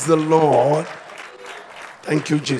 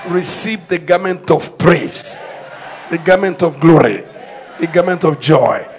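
loud commanding preacher's declaration; preaching at full force; 8 dB louder than usual. Acted. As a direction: proclaim victory over the church.

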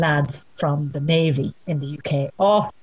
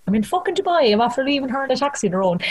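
retold panic; 7 semitones higher, faster than usual. Panic alone in Dubai.

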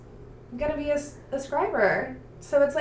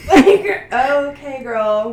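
sassy laugh at the heroine; 5 semitones higher, 13 dB louder, much slower than usual. Real, not acted.

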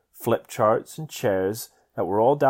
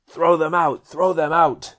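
higher, louder angry command; furious spirit; 6 semitones higher, 5 dB louder, slower than usual. A narrator's reading. Spirit urging man to throw sisters out, furious. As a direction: order them out in a furious spirit voice. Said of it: "it was furious and angry".

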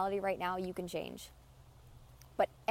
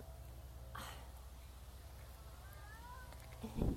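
long heavy sigh; 12 semitones higher, 12 dB quieter than usual. Real, not acted.

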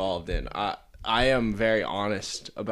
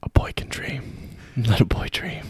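wistful whisper; 4 semitones higher, 3 dB louder than usual. Pretend. Wistful aside.

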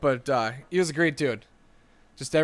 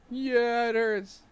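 put-on sniffly kid; 9 semitones higher, slower than usual. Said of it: "He'd come up and go".

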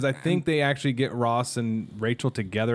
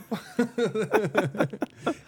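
high laugh; 8 semitones higher, 3 dB quieter than usual. Real, not acted. Laughing after movie quote.

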